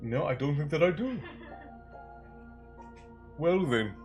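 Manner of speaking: ordinary delivery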